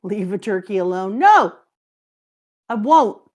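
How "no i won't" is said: a sudden high, loud refusal; a jokey, defiant yelp of no; loud playful no. Real, not acted. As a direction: shout it back, teasing and stubborn. Playful refusal to drop turkey.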